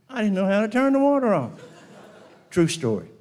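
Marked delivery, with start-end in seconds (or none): 0.1-1.5 higher, louder, fast confession; loud fast punchline. Retold or imitated. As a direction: blurt the confession out. Mikey's sheepish punchline.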